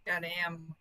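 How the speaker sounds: ordinary talk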